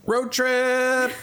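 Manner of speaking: high, loud excited cry. Real, not acted. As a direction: shout it out like a sudden plan. Excited shout at listener's invitation.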